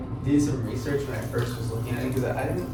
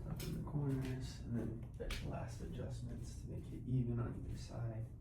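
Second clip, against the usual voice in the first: hushed, soft-spoken morning talk; quiet; 17 dB quieter than usual. Real, not acted. I gotta be a little quiet 'cause Sean is sleeping.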